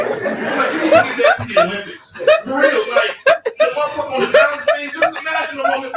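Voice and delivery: high, loud amazed rant; excited. Real, not acted. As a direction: shout it in giddy disbelief. Excited crackhead bit.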